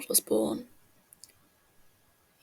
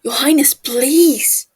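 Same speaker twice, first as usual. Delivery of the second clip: loud call from behind, voice pitched up; 4 semitones higher, 13 dB louder than usual. A narrator's reading. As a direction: shout after the racing prince to stop him. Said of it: "calling out behind him".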